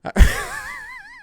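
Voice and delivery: high burst of laughter; helpless high laugh; real laugh. Real, not acted. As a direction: crack up at your own accent. Laughs at own bad accent.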